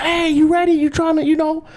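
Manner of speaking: high-voiced, expectant check-in; eager caller. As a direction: put on a high, eager phone greeting. Acts out the caller's eager question.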